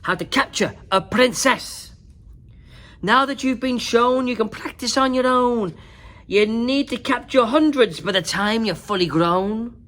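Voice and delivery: loud, high teacher dragon urging the class; bossy dragon teacher. A narrator's reading. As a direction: project like a drill teacher. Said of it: "said Madam Dragon".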